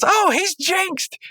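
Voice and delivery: very high, drawn-out cartoon exclamation; Pops exclaiming. Pops realizing Rigby is jinxed, high drawn-out exclamation. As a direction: cry out slowly in a silly high voice. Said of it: "or Pops goes".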